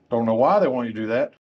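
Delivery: ordinary talk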